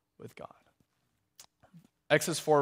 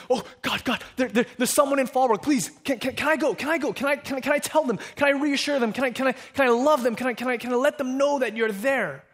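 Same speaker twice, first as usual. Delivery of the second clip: much higher, louder, rushing pleas; eager excited Gabriel; 8 semitones higher, 4 dB louder, faster than usual. Retold or imitated. Gabriel pleading with God to go, high and excited. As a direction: beg like a thrilled child. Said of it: "he starts doing the little twinkle toes".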